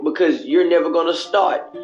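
ordinary delivery

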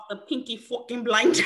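short burst of Irish accent; playful stab at an Irish accent. Shown as a demonstration. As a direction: attempt an Irish gangster's line. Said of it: "Give me your Irish accent".

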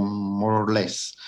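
ordinary talk

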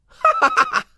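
high gleeful cackle; big laugh. Acted. Wukong laughing at the princess's useless fan. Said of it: "大笑着说".

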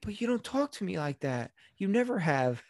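put-on high, wounded child's protest; feigned hurt. Son guilts his apologizing mother, feigned hurt. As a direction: lay on the injured innocence.

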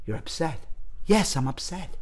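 soft, restrained exchange; quietly upset. Shown as a demonstration. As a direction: start the exercise hushed. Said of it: "it always would start out something like this".

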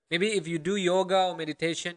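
ordinary delivery

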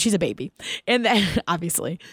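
ordinary talk

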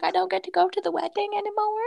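high, quick little whine; mock-pouty high child voice; sad child imitation. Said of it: "she was so sad".

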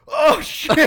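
very high, loud mock shout of horror; panicked realization. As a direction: yell it like waking up to a disaster. Acts out waking to his mistake.

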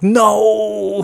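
a high yelled no as the call cuts out; anguished no. Retold or imitated. Caller howling as the dropped call cuts out, high yelled no. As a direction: wail it like the line just died. Call drops mid conversation.

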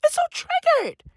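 mocking outraged critics. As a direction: imitate the offended crowd. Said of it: "motherfuckers were triggered".

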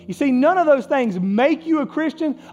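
fervent pulpit delivery; impassioned preaching. Real, not acted. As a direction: preach it high and strong, punching key words. Preaches at full force.

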